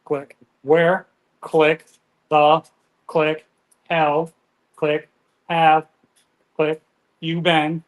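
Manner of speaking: each word clipped off, clicking between; one word at a time, broken message; staccato. Shown as a demonstration. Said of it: "I do it one word at a time".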